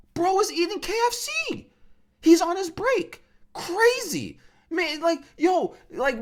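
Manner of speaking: high-pitched, exasperated comic rant; mock outrage. Waiting customer ranting about barber eating fried chicken, high mock indignation. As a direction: squeal in disbelief at the disrespect.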